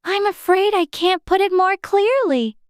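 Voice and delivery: put-on mildly polite little-girl reply. A narrator's reading. Alice apologising to the caterpillar, very polite. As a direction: answer with careful courtesy. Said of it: "Alice replied very politely".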